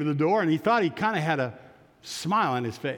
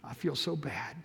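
put-on very soft, broken, teary boy's voice; tearful confession; 2 semitones lower, 7 dB quieter than usual. Ashamed boy confesses to the missionary, softly weeping. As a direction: barely get it out through tears. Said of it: "the tears came".

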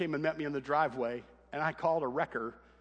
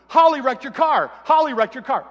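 shouted much higher and louder, almost enjoying it; gleeful yell; 10 semitones higher, 15 dB louder than usual. Retold or imitated. Brother running downstairs announcing the wreck, gleeful shout. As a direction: yell the news with a hint of glee.

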